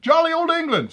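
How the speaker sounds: put-on jolly old England. Jokey aside about England.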